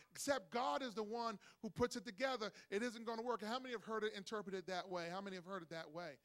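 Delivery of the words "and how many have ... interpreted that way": ordinary delivery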